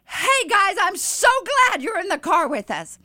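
high-pitched gushing greeting; loud and excited. Retold or imitated. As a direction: greet them with over-the-top excitement. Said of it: "as loud as I could, as an excited I could".

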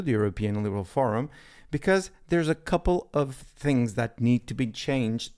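ordinary delivery

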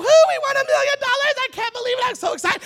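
high, loud whooping celebration; screaming with joy. Acted. Winner shrieking over a million dollars, ecstatic. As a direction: shriek it like you just won a fortune. Friend celebrating winning raffle.

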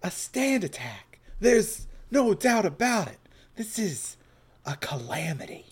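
put-on Southern accent. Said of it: "Southern, Southern".

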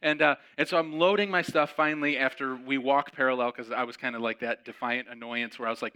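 normal talking voice

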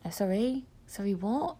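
soft, puzzled query; hesitant confusion. Acted. Blind woman to an unknown greeter, soft and confused. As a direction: ask quietly, unsure who is there. Acts not recognising a greeter.

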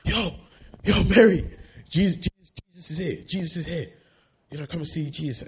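high, loud call, easing off slightly; urgent calling out. Acted. Martha rushing in to tell Mary Jesus is here, urgent calling out. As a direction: burst in and call out the news, pitched high.

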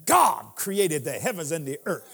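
scripture read, deep and measured; booming biblical narration. Acted. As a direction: intone it like Genesis. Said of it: "and I quote scripture".

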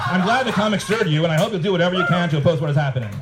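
a hint of mock earnest preaching; mock sanctimony. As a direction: author mocking his own pious reply to a critic. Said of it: "This is me".

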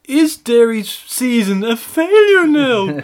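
high, slow, pompous TV presenter; mock pundit voice. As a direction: ham up a solemn studio host.

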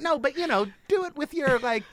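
high, slowing mock voice of a condescending classmate; a mocking take on a patronizing New England classmate. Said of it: "And he's like".

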